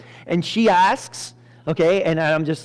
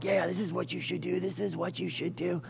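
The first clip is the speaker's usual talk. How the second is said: hushed, much softer chorus of advice; conspiring friends; 11 dB quieter than usual. Acted. Haman's friends advising him, hushed and conspiring. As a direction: softly egg him on like scheming pals.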